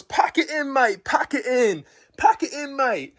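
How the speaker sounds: high, drawn-out mock Australian order; Australian gym teacher. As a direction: imitate an Australian teacher calling a halt. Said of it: "he goes".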